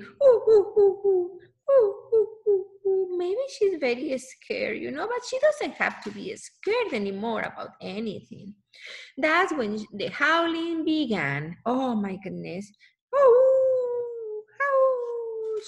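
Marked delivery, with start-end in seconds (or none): 0.0-3.2 high, loud whimpering ooh sounds; whining dog. Acted. Reading Lucy's story aloud, high loud dog whining. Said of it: "she's whining". 13.1-15.6 high howl; dog howling. Acted. Voicing Lucy at night, high howling. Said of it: "she is howling".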